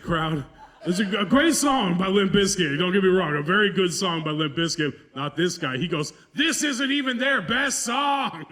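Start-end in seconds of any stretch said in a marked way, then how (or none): 6.3-8.4 high, drawn-out mock hillbilly; dumb hillbilly. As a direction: whine it slowly up high like a hillbilly fan. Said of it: "you dumb hillbilly".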